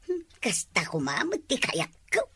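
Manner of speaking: normal talking voice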